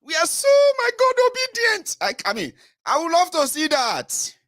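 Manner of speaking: very high, loud mock rapture; mock gushing. Mocking obedient supporters, high gushing rapture. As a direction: gush like a devotee.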